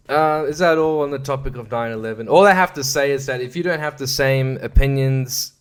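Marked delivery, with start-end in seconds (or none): none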